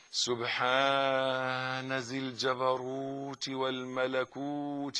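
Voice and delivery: very slow and soft; drawn out, reverent and hushed; murmured bowing prayer; devout dhikr. Shown as a demonstration. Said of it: "تقول كمان".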